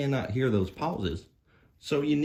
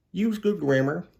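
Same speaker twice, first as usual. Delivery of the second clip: emphatic advice; 8 semitones higher, 5 dB louder than usual. Acted. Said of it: "parting advice to viewers".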